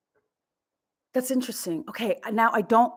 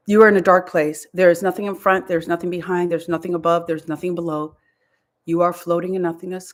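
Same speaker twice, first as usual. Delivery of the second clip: guided meditation; 4 semitones lower, 5 dB louder than usual. Acted. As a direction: lead her into the void, calmly. Guided trance induction.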